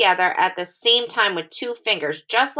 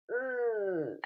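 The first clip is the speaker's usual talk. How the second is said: muffled, much softer mm with the nose pinched; 13 dB quieter than usual. Shown as a demonstration. Teacher demonstrates a pinched-nose hum. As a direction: squeeze out a blocked-nose hum. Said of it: "Pinch your nose".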